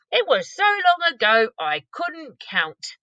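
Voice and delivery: giant musing about age; lightly voiced pondering giant. BFG voiced.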